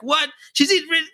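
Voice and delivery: high, rising, laughing astonishment; amazed disbelief. Retold or imitated. A parent amazed at toddler singing, high and laughing. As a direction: react in delighted shock, voice climbing. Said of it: "you're like".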